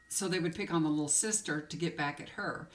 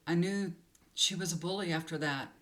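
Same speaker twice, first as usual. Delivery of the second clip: normal talking voice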